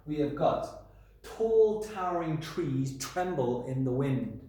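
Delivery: tall towering trees tremble with each t hit hard; slow, punchy alliteration. Shown as a demonstration. Teacher reading an example sentence to class. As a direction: lean on every t sound slowly. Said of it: "I've given you some example sentences".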